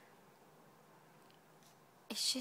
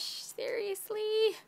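much higher, slow, incredulous; high disbelief; 11 semitones higher, 4 dB louder, slower than usual. Real, not acted. Getting pricey markers from a friend, incredulous. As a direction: drag it out in disbelief.

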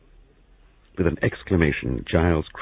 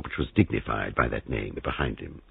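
usual speaking voice

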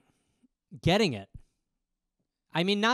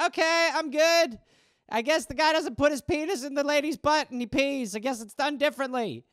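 put-on a boy trying to end the talk; awkward kid; 7 semitones higher than usual. Boy backing out of dad's talk, higher.

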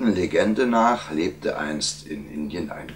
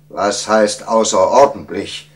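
loud, higher royal interruption; impatient; 5 semitones higher, 8 dB louder than usual. A narrator's reading. King interrupting his court mathematician, impatient. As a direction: cut in with an impatient demand. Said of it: "unterbrach ihn der König ungeduldig".

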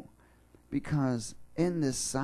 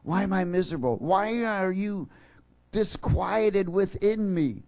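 higher, slow pleading; inner struggle; 6 semitones higher, slower than usual. Acted. Preacher voicing the psalmist wrestling inside, slow and pleading. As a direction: plead with your soul, drawn out. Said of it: "he's having a fight within him".

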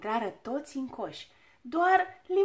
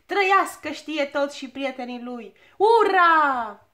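louder joyful cheer ending in a big shout; 3 semitones higher, 10 dB louder, slower than usual. A narrator's reading. Crowd hailing the travellers as they fly off, loud cheer. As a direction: hail the heroes with all your voice. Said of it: "strigară piticii".

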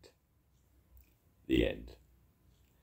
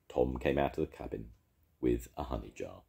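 plain reading voice

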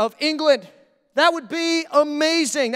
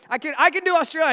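ordinary delivery

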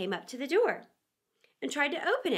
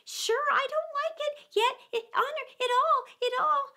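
very high Irish lilt; easygoing Irish gardener answering his master; Irish gardener answering the rabbit; Irish servant; 14 semitones higher than usual. A narrator's reading. Pat protesting to rabbit.

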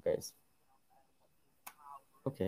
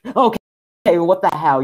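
loud, high outburst of alarm; jolted, raised-voice disbelief; startled exclamation; 7 semitones higher, 10 dB louder than usual. Real, not acted. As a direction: react to a sudden game scare. Startled by the game.

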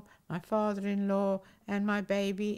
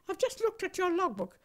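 higher, outraged retelling of his rant; embarrassed instructor scolding her, higher-pitched outrage; livid instructor; 7 semitones higher than usual. Retold or imitated. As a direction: mimic the red-faced examiner. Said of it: "and he was livid".

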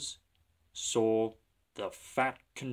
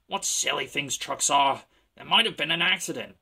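scornful; 3 semitones higher, 6 dB louder than usual. Acted. James scornful.

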